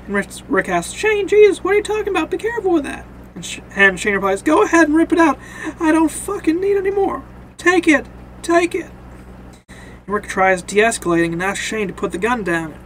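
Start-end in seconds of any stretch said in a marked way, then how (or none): 0.9-3.0 acted alarm. Rick alarmed at pointed gun. 4.4-8.9 high-pitched, heated challenge; worked-up defiant outburst; acted angry dare. Shane dares Rick, retold.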